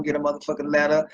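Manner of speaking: ordinary talk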